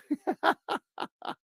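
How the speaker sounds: high, drawn-out laugh. Real, not acted. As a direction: crack up at your own joke. Laughing at own joke.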